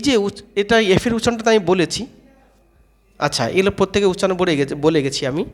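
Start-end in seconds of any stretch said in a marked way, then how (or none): none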